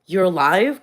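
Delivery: loud, imitated disbelief; mock shock. Acting out everyone's reaction to seeing the speaker, mock shocked. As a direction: blurt it out as their stunned reaction. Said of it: "Everyone was so fucking shook".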